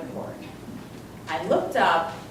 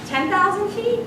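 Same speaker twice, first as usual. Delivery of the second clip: high, louder, rising hopeful question; hopeful guess; 12 semitones higher, 5 dB louder than usual. Retold or imitated. Hopefully guessing the altitude to her instructor. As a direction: ask it hoping.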